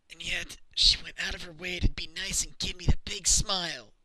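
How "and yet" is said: ordinary delivery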